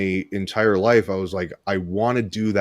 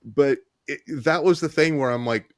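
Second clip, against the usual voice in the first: ordinary delivery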